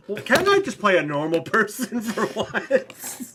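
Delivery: high, slow mock complaint with a laugh; whiny brother. Imagining James Gunn's brother complaining, high whiny laugh. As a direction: whine it like a put-upon actor. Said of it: "And it's like".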